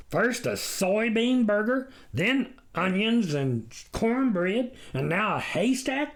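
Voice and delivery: marveling. A narrator's reading. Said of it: "the farmer marveled".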